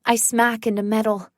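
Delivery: plain reading voice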